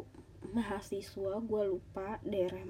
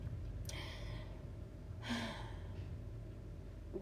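heavy sigh; 11 dB quieter than usual. Real, not acted. Sad vlog confession.

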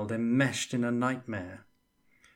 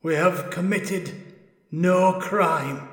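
high, slow, sorrowful ghost; a lonely spirit pleading its innocence; ages of suffering and loneliness; 8 semitones higher, 7 dB louder, slower than usual. Acted. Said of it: "in a voice that held ages of suffering and loneliness".

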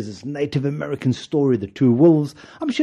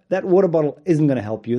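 ordinary talk

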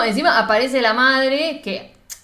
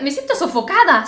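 mock protest, raised and louder; retold quarrel; 4 semitones higher, 5 dB louder than usual. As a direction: act out an argument, indignantly. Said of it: "ahí se empezaron a pelear". (protesta de pelea imitada, más aguda)

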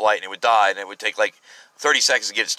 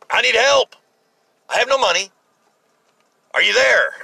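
high, louder plea with pauses between cries; retold desperate prayer; 12 semitones higher, 4 dB louder than usual. Broke driver cries out to God for help, retold desperate prayer. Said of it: "I prayed to God".

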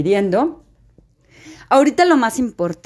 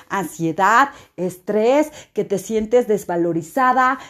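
normal talking voice

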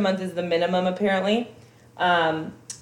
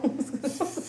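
short high-pitched giggle; breathy little laugh burst; 6 semitones higher, 9 dB quieter than usual. Real, not acted. Laugh after joke.

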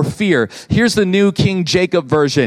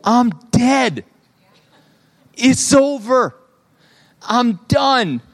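higher-pitched mock panic, in short separate bursts; retold panic; 5 semitones higher, much slower than usual. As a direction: act out freaking out, pitched up, without shouting. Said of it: "I'm freaking out".

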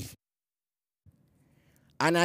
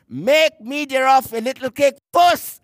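"first" lifted and leaned on at the end; stress on first; 3 semitones higher, 3 dB louder than usual. Shown as a demonstration. Preacher to congregation, leaning hard on "first". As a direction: make the word "first" ring out rising. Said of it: "place emphasis on that word first".

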